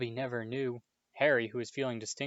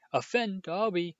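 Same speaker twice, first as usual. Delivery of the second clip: higher, slow choked cry; 8 semitones higher, 4 dB louder than usual. A narrator's reading. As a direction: choke the words out in tears. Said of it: "choked the elf".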